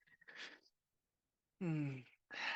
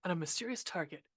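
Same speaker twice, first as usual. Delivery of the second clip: low, softer on-screen text reading; title card read-out; 5 semitones lower, 5 dB quieter than usual. Acted. Reads title screen text aloud.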